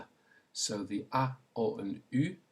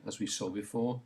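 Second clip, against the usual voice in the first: ordinary talk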